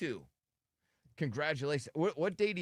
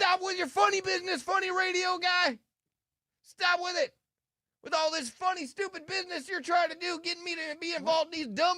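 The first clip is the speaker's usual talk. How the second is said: angry high rant; 4 dB louder than usual. Pretend. Mock rant as Nard.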